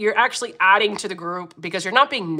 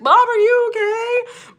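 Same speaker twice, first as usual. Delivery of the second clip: much higher, slow voice of a worried son, laughing; mock worried son; 14 semitones higher, 3 dB louder, slower than usual. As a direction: laugh through a high worried-kid voice. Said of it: "be like".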